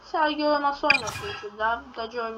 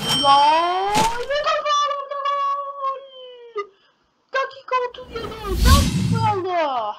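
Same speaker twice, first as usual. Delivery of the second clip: high, soaring goal cry; commentator goal call; 11 semitones higher than usual. Pretend. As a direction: yell the goal like live TV.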